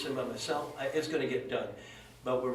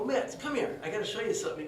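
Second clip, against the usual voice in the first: high-pitched excited summons; eager call; 7 semitones higher than usual. Retold or imitated. Teller calls Mitz to his truck, excited. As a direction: call him over, bursting with a surprise. Calling friend over excitedly.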